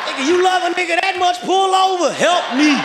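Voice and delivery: high, loud plea across the street; loud mock begging from the curb; calling out for help. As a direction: shout to the fans to pull over.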